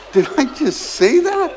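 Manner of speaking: amused at your own blunder; laughing disbelief. Real, not acted. Amused at his own gaffe.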